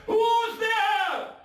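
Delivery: high, loud startled cry into the dark; a frightened old man shouting from his bed. Acted. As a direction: cry out in sudden fear. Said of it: "crying out".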